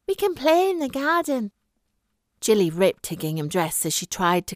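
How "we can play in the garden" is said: put-on higher, eager little girl's voice; a friendly child inviting her friend home; young girl. A narrator's reading. Narrator voices young friend Suzanne.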